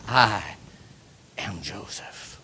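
slow whisper of "am Joseph"; whispered reveal. Acted. Joseph revealing himself to his brothers, whispered. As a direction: let Joseph's secret out on a breath.

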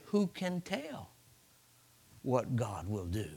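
slow and hushed, voice sinking at the end; subdued grieving answer. Retold or imitated. David answering his servants in grief, slow and subdued. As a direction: say it softly, drawn out, with a long pause.

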